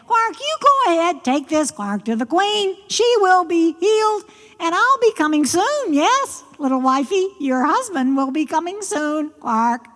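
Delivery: high frog voice, sending the bride off; high-pitched, doting frog husband; bossy frog husband. Acted. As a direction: play a self-satisfied frog giving orders.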